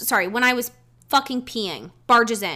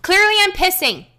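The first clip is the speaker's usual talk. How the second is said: higher, louder sarcastic retort; indignant; 8 semitones higher, 5 dB louder than usual. Retold or imitated. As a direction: make it obvious, fed up. Indignant answer to intruder.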